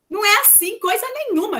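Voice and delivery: loud, high, emphatic contradiction; firm rebuttal. Real, not acted. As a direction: flatly reject it, raising pitch and volume. Firmly rebutting her mother's quoted advice.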